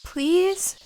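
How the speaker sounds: high pleading rising please; begging. Acted. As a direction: plead like a child. Begging for a piggyback.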